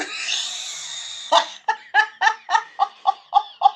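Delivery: long high-pitched giggle; cackling over the prank; high giggling laugh. Real, not acted. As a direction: break into giggles. Laughing at her own prank.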